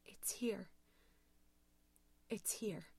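hushed, frozen-with-fear delivery; low, somber voice. A narrator's reading. Paralyzed Louise tells the operator, low and somber. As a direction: say it softly, with long dread-filled pauses. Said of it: "spoke in a low, somber voice".

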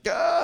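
high startled ah of disgust; mock disgusted yelp. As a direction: recoil from the smell with a yelp. Reacting to baby diaper blast.

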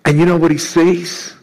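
ordinary talk

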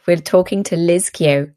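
ordinary talk

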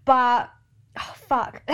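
laughing through sudden tears; a choked little laugh as emotion hits; teary laugh. Real, not acted. Laughing through tears while telling her audience about the trip. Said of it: "why am I literally getting emotional?".

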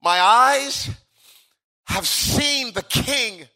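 awestruck, high, drawn-out proclamation; Isaiah. Acted. Prophet who has seen the King, awestruck cry. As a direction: proclaim the vision slowly, pitch lifted. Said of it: "Isaiah says".

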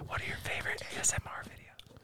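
soft unvoiced whisper. Real, not acted. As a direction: whisper it close to the mic. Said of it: "we're whispering into the mics".

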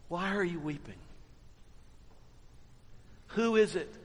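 hushed, tender question; gentle and soft. Acted. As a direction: ask softly, like comforting a grieving friend. Jesus gently asks weeping Mary.

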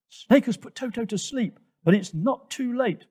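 high, urgent alarm call; urgent yell. A narrator's reading. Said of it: "Twigger yelled".

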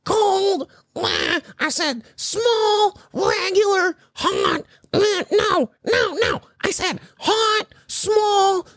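high, put-on voice of a fussy customer; imitating the lady. Mocking the line cutter ordering at the drive-thru, high put-on voice. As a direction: mimic her rattling off the order. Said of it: "she's going".

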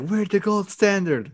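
high, slow mock muttering; mock background mumbling. Co-host imitating crew overhearing the rant, mock mumbling. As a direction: imitate someone puzzled in the background. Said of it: "You just hear mumbling in the background".

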